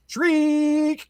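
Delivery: very high mock animal shriek; possum shriek. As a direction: screech it like a startled possum. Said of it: "the possum just goes".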